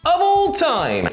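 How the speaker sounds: high, drawn-out chant. Pretend. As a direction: lead the kids in a slow chorus. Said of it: "say it with me, kids".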